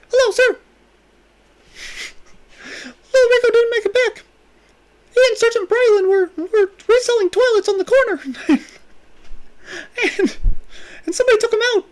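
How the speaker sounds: squeaky, very high cartoon mouse voice; Mickey Mouse voice. Twelve-year-old reporting to the boss, squeaky cartoon mouse voice. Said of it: "he's got, like, a Mickey Mouse voice".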